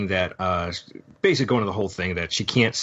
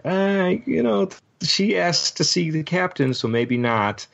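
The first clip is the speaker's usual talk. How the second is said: higher, hesitant mock voice; 11 semitones higher than usual. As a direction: voice the doubtful crew, pitched up. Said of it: "people are like".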